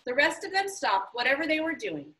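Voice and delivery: usual speaking voice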